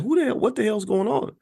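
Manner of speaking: imitating a livid man, pitched high. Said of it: "He was livid".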